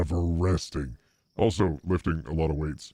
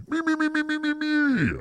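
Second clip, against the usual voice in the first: high singsong me-me. Acted. Villain singsong ego.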